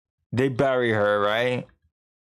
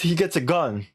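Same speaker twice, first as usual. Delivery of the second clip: ordinary delivery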